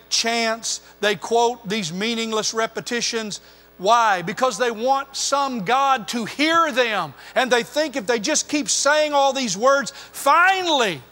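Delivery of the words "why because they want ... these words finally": swelling from plain to a loud, high climax on finally; rising pulpit fervour; building preaching. Acted. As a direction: drive it up like a revival preacher. Preacher builds on repeated pagan prayers.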